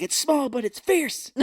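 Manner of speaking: high, slow, hammed-up comeback; mock boast. Retells boastful comeback, laughing.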